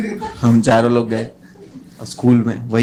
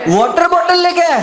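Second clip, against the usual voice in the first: high, laughing squeal; laughing disbelief; 14 semitones higher, 3 dB louder than usual. Real, not acted. Comedian laughing at the kid's water bottle, high squeal.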